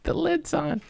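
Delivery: high squeaky remark; squeaky aside. Real, not acted. Stoned host squeaking about the lid on his drink. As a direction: squeak it playfully.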